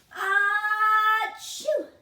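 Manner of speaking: loud, high acted sneeze; big sneeze. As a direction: burst out a big achoo. Bear sneezes in story.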